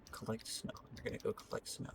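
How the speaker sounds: hushed whisper, breathy and unvoiced. Shown as a demonstration. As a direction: whisper the order under your breath.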